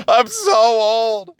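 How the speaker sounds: high, slow, whiny wail; hammed-up self-pity; mock lament. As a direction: drag it out like a groaning old man. Joking about his age.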